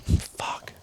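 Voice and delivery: voiceless, breath-only curse; soft whispered swear; whispered dismay. Retold or imitated. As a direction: mimic a stunned buddy's whisper. Buddy's dismayed whispered reaction.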